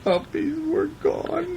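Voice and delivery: very high, slow fake sobbing; hammed-up crying for the lonely puppy; mock crying. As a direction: wail the words like a sad puppy. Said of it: "Look at crying".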